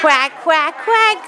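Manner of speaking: playful quacking; duck quack. Shown as a demonstration. Teacher answers duck sound.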